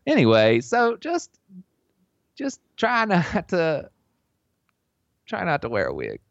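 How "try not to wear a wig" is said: ordinary talk, nothing marked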